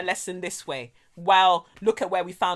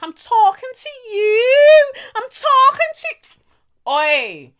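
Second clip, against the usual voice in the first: high, loud, slow drawn-out yell; mock drunken yell; 10 dB louder, much slower than usual. Drunk girl shouting at Kelechi outside, mock drawn-out yell. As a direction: stretch it out like a drunk picking a fight.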